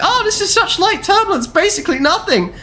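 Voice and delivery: high mock-casual instructor voice; mocking the unbothered flight instructor. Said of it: "And the instructor's like".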